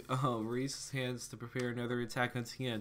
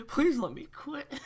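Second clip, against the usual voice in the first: high plea; 6 dB louder than usual. Acted. Begging plea in voiced story.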